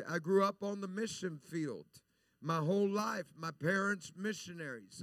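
ordinary talk, nothing marked

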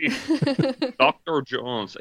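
failed Russian accent attempt; squeaky mock Russian accent. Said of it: "I can't even do it".

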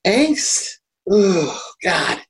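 a pained, high "Angst" then a groan of disgust. Real, not acted. Podcast host groans at the film he's recommending. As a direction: recoil at the memory of the film. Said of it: "deeply unpleasant film".

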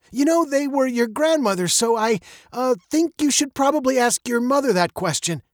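hesitant evasion, pitched high; stall, then pass the question on; faltering father. A narrator's reading. Father defers to her mother, hesitant. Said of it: "he faltered".

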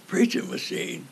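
breathy whispered name, barely voiced; a sick friend's faint whispered greeting; weak whisper. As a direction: whisper it like a weak man in a hospital bed. Sick friend who couldn't talk without crying.

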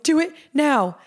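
retold excited command; eager urging. Urging friend to act at once.

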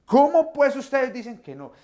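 hammed-up exasperation; exasperated scolding. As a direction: throw up your hands at friends who still don't get it. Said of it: "Yo no me imagino yo respondiéndoles de esta manera".

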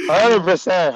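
loud, high hundred percent; excited yes from the gut; emphatic agreement. Real, not acted. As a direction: agree with full force.

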